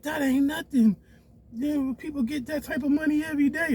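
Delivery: high, scornful mock of her voice; mimicking his girlfriend. Girlfriend dismissing the twenty thousand, high mocking voice. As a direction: sneer it high like she's brushing him off. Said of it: "She like".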